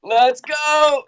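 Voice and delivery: high-pitched excited shout; excited cheer. Real, not acted. Cheering as a requested guest arrives, high excited shout.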